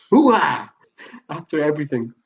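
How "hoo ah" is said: loud hammed-up Pacino bark; Scent of a Woman hoo-ah. Host imitating Pacino's Scent of a Woman cry. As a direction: bellow it like the colonel. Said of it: "scent of a woman".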